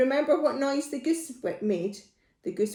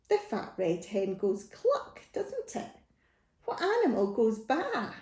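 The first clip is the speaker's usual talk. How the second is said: ordinary delivery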